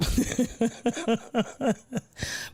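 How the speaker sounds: soft, breathy run of chuckles; laugh. Real, not acted. Streamer tickled by a chat comment, laughing. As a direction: wheeze out a long quiet laugh.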